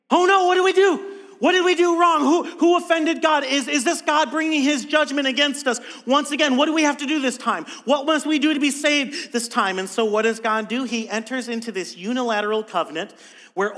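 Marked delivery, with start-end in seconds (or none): 0.1-9.8 high, loud mock panic; panicked imitation. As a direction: play Noah's frightened family in a fluster. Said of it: "panic, right?".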